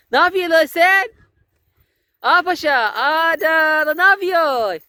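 high, loud excited calls; excited dog talk. Real, not acted. Owner delighted watching her dog play, high excited. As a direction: delight at the dog's antics.

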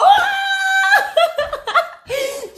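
long, very high squeal of laughter; delighted laugh. Real, not acted. Over the unboxing, a high loud squealing laugh. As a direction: laugh out loud, giddy with joy.

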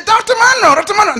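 high, loud call over a crowd; eager calling out. Retold or imitated. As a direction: push through the crowd with your voice. Lady calling through the crowd.

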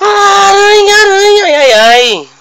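high, loud startled gasp; startled yelp. Real, not acted. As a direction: react to a spider in sudden fright. Spider scare.

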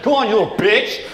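high, loud jeer from a pushy friend; mock taunting. Comic as friends insulting him into shooting, mock taunting.

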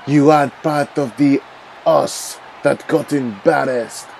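usual speaking voice

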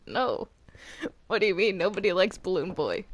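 normal talking voice

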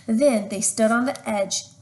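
plain reading voice

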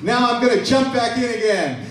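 high, brash hero boasting; Daniel bragging about the lions, high and bold; cocky Daniel. Retold or imitated. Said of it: "and he says".

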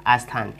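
आस्थान with a clear short आ before स्थ; correct Hindi pronunciation. Shown as a demonstration. Teacher showing students the right way, आस्थान said deliberately. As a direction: say आस्थान, vowel first then स्थ. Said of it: "ये सही है".

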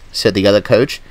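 plain reading voice

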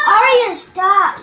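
loud, high, drawn-out telling-off; real scolding shout. Real, not acted. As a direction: call out to stop someone, slow and loud. Scolding someone in the way.

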